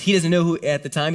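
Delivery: ordinary talk, nothing marked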